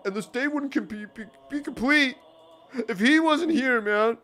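emotional groomsman. Acted. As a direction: announce a surprise, swelling with emotion. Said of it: "and he goes".

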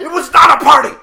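high, very loud indignant yell; angry shout. Acted. Friend calls hospital visit a party, angry shout. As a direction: shout him down in outrage.

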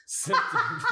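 high squeal breaking into laughter; laughing squeal. Real, not acted. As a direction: squeal the word through laughter. Grossed out by diarrhea.

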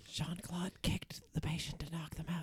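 hushed, voiceless aside; very soft conspiratorial whisper; whispered advice. Acted. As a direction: whisper a secret tip to a colleague. Secret advice behind patient's back.

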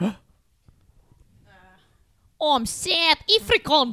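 put-on improvised South African accent. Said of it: "now I have to speak like this".

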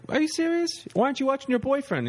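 very high falsetto disbelief; mock incredulous comic. Comic teasing the girlfriend, in a high incredulous falsetto. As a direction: squeal it in mock astonishment. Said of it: "He's like".

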